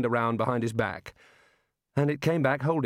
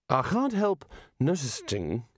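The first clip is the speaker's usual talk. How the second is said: hesitant Rincewind; 2 semitones higher, slower than usual. Acted. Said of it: "he tried again".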